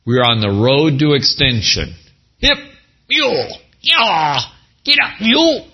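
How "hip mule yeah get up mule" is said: mule driver calling. Pretend.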